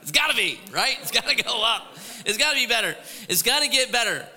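it's gotta be better, pushed up; insistent hope. Real, not acted. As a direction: talk yourself into it, half joking. Pastor hyping hope for new year.

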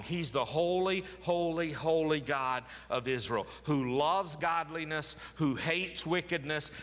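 normal talking voice

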